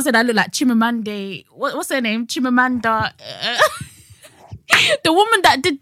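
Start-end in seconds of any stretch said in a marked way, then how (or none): none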